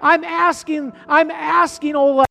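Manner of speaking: loud, impassioned preaching, slowing on the key questions; preaching at full force. Acted. Pastor preaching to his church, loud and impassioned. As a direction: drive the sermon home to the church.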